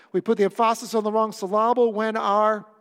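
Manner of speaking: loud "em-FAH-sis" for "emphasis", "sil-LAH-ble" for "syllable"; projected joke mispronunciation of "emphasis" and "syllable", second syllable stressed; wrong-syllable stress. Shown as a demonstration.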